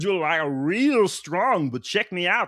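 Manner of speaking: put-on high, wild over-the-top comic accent; silly high-pitched generic accent; Doom. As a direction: boast like a goofy foreign cartoon villain. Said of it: "maybe that's what Doom should be".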